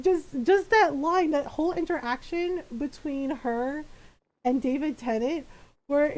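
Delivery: ordinary talk, nothing marked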